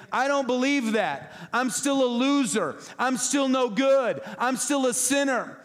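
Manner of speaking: high, loud, whiny self-pity; mock whine of a believer who says he is no good. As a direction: wail it like a defeated loser. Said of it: "Arrogant is saying".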